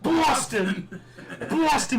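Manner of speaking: mock Boston accent, laughing. Father hamming a Boston accent for son. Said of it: "I gotta talk my Boston accent".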